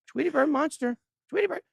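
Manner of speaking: very high cartoon squeak; high squeaky cartoon bird; squeaky Tweety Bird voice.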